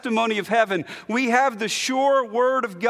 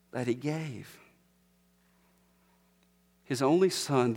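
reverent hush; 8 semitones lower, 5 dB quieter than usual. Acted. Preacher quietly reciting the whole verse to the congregation. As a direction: read it like a whispered prayer.

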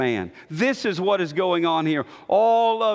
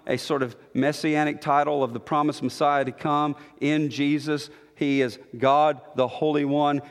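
usual speaking voice

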